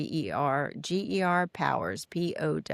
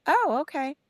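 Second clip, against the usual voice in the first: higher, lightly surprised "oh, okay"; mild surprise; 10 semitones higher than usual. Retold or imitated. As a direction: react with a light lift of surprise. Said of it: "I'm like".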